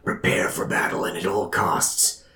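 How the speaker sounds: breathy divine whisper; dramatic whisper. Acted. God speaks into a man's mind, dramatic whisper. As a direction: whisper it like a voice from the gods. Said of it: "a dramatic voice".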